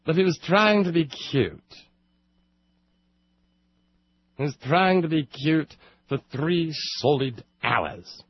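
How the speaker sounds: high, hammed-up phony British accent; mock British accent. Host mocking a radio sub, phony British accent. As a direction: play the cute fake Brit. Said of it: "With a British accent is about as phony".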